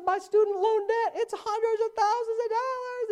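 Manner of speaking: a lightly hammed-up sobbing complaint; imitating crying woman. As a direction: play her distressed and weepy. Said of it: "she's crying and sad".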